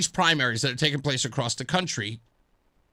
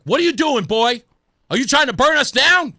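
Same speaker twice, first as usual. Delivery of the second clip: louder, higher mock scolding of a boy; accusatory parent; 6 semitones higher, 7 dB louder than usual. Father yelling at his son caught playing with matches, accusatory and loud. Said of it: "Knee jerk".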